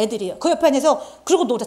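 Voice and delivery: ordinary delivery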